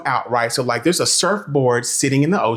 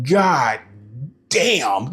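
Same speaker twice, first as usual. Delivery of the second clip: ordinary delivery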